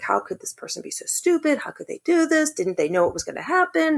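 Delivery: high-pitched exasperated rant; retelling her rant to her aunt, voice high and indignant; retold venting. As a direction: replay your own outrage, voice shooting up. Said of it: "And I was like".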